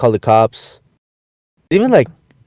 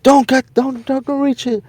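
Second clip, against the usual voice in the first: mock cop yell; 14 semitones higher than usual. Cops warning naked man.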